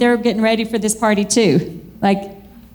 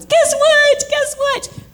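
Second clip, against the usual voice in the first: excited call; 14 semitones higher, slower than usual. Retold or imitated.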